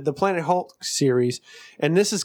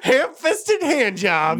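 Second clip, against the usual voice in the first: slow, laughing through the words; 8 semitones higher, 4 dB louder, much slower than usual. Real, not acted. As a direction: crack up while saying it. Cracking up at the joke.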